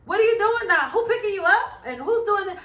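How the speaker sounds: high-pitched string of nosy questions; worried questioning. Retold or imitated. Mother quizzes her son before he goes out, worried. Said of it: "a worried tip giver".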